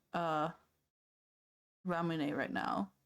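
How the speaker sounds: ordinary talk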